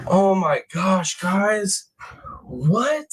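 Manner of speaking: high, slowed oh my gosh; letting the shock sink in; stunned. Real, not acted. Streamer telling chat about the arrest, slow stunned.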